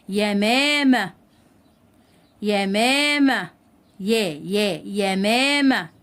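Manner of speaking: "يمامة" very slowly with a distinct opening "يا"; clear ya sound. Shown as a demonstration. Teacher demonstrating ya with fatha, slowly enunciated. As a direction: stretch "يمامة", isolating "يا" before the last take. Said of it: "نطقت الياء بشكل واضح".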